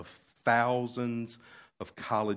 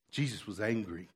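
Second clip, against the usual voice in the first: ordinary delivery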